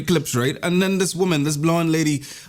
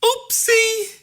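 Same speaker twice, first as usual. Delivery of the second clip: very high, sing-song oops; barber's oopsie; 13 semitones higher, much slower than usual. Acted. Barber drops a big tuft of hair: very high sing-song oopsie. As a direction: chirp oopsie like a guilty child.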